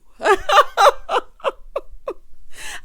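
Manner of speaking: high, loud peal of laughter; real helpless cackle. Real, not acted. Host cracking up at Maurice's comeback, loud laughter.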